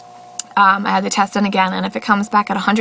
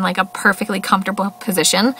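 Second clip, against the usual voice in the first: ordinary delivery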